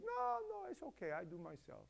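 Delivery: put-on light Romanian accent, brushing off help. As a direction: shrug it off in a mild Romanian accent. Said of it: "talk like Russian".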